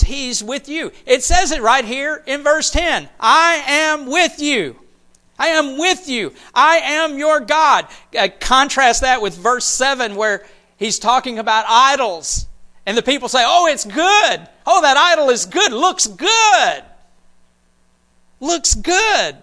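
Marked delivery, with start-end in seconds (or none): 3.2-7.9 higher and slower, announcing it with weight; proclaiming God. Acted. A preacher proclaiming God's promise to the congregation, high and slow. 13.5-19.4 high, hammed-up gushing; mock admiration. A preacher mocking people who admire their idol, hammed-up gushing.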